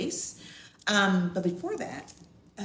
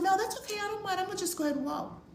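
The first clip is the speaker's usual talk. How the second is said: high, rushed nervous no-thanks; high fast flustered decline; flustered refusal; 13 semitones higher, 3 dB quieter, much faster than usual. Retold or imitated. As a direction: brush off the offer, anxious. Retold insistent refusal.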